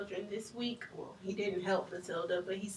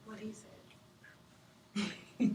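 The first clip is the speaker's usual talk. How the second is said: whispering; 3 dB quieter, much slower than usual. Acted. Wife defers to husband, whispered.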